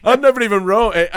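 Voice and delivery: high, loud laughing aside. Real, not acted. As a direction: laugh at yourself mid-sentence.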